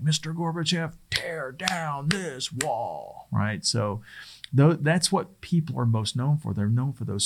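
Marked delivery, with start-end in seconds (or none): 0.0-3.3 Reagan impression. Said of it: "Reagan say something like".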